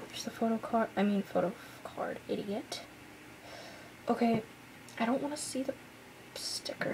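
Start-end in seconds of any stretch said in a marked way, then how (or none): none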